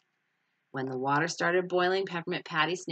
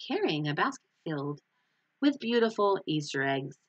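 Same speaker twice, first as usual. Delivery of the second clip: ordinary delivery, nothing marked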